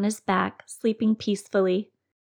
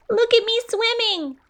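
very high squeaky little voice; squeaky Roo. A narrator's reading. Roo showing off while swept downstream, squeaky high. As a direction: squeak it like a proud tiny joey.

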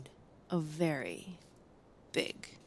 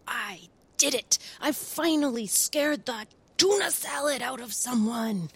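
gloating monster; 5 semitones higher than usual. Acted. Leonardo gloats over scaring Sam, jeering. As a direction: boast like you finally won. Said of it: "jeered Leonardo".

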